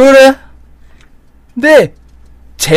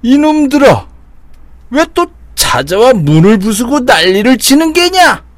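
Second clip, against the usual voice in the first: angry shout; 8 semitones higher than usual. Acted. Demon king confronts intruders.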